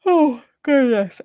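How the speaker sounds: words stretched by a sudden yawn. Real, not acted. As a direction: yawn right through it. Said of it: "Sudden yawn came over me".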